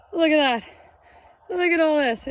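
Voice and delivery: loud high showing off; excited. Real, not acted. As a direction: proudly show the haul. Showing huge harvest.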